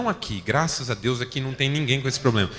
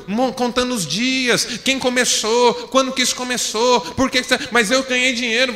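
usual speaking voice

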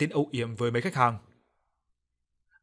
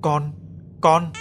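louder, urgent cry; calling for her child; 4 semitones higher, 6 dB louder than usual. Acted. Mother calls her child in a nightmare, calling out. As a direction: call out to a lost child.

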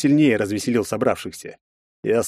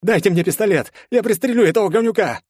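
loud, much higher raving; enraged yell; 11 semitones higher, 4 dB louder than usual. A narrator's reading. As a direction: rival out of control demanding a gun. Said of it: "рявкнул тот". (в бешенстве)

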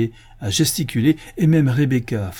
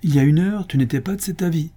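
high, insistent objection; protesting cry; 7 semitones higher, faster than usual. A narrator's reading. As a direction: contradict with raised pitch. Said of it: "s'écria Esther". (objection vive, voix aiguë)

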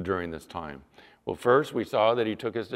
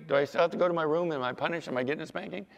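put-on quick, higher-pitched kid's questions; bargaining child; 5 semitones higher, much faster than usual. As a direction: fire off questions like a child fearing punishment. Acts child bargaining over punishment.

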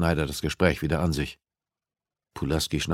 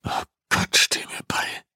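whispered, fighting tears; a desperate breathless plea to God; whispered prayer; slower than usual. Acted. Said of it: "kämpfte erneut gegen Tränen an".

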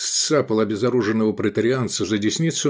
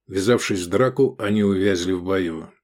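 ordinary delivery

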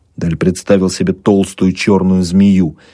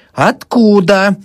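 high, drawn-out insistent question; demanding; 5 dB louder, much slower than usual. A narrator's reading. Old woman gripping the doctor's shoulder, demanding. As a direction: demand it slowly, pitched high. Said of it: "требовала старуха".

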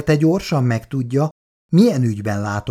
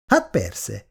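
usual speaking voice

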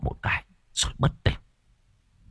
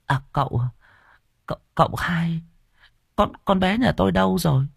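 put-on much higher, hesitant old woman's voice; anxious old woman; 9 semitones higher than usual. Old mother asking the young master about her daughter, higher and hesitant. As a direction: stammer out the question, worried.

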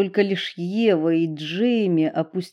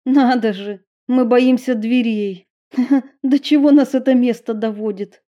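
laughing, slightly raised voice; 5 semitones higher, 5 dB louder than usual. A narrator's reading. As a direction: laugh off the fright. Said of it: "Ребята невольно засмеялись".

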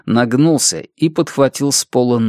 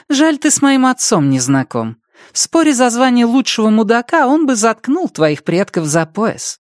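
much higher, mocking female character; Andrea's taunt; 11 semitones higher than usual. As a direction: needle him in a high, level voice. Andrea taunts the man with knife. (насмешливо, высоким женским голосом)

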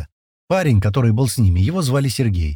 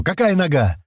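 higher, urgent demand; shouted question; 7 semitones higher than usual. Acted. Senior man needs the bitten leg, shouting. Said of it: "крик старшего".

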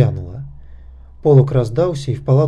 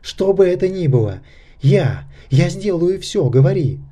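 higher-pitched, desperate pleading; crying out; 5 semitones higher than usual. A narrator's reading. Misha begs Nakhta to reveal the secret, crying out. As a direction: insist frantically, in broken bursts. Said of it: "крикнул Миша".

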